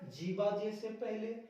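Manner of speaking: ordinary talk, nothing marked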